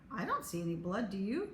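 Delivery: usual speaking voice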